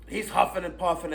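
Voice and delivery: ordinary talk, nothing marked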